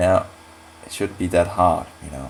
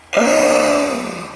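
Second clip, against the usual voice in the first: gasp; 7 semitones lower than usual. Real, not acted. Reacts to the swallowed bug.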